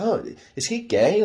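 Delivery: high mock question in another voice; imitating her suspicion. Imagining the girl doubting him, a high mock voice. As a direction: toss it up high like a nosy girl. Imitating what she might think.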